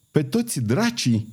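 understated shout of astonishment; exclaimed oath. A narrator's reading. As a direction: cry out the oath without volume. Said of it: "strigă Bussy".

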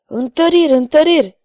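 high, loud cry for reinforcements; yelling for help. A narrator's reading. Garconio shouts for reinforcements during the sword fight, high and loud. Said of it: "urlă Garconio".

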